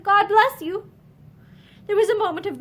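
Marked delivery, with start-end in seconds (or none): 0.0-0.9 choked farewell. A narrator's reading. Lynn's final farewell.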